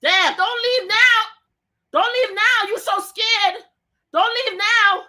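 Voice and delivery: yelling pleading. Real, not acted. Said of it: "I was screaming at the top of my damn voice at him".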